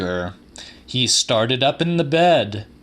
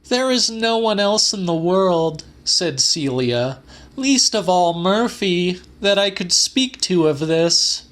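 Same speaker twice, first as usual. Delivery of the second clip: Celia's pleading voice; 7 semitones higher than usual. A narrator's reading.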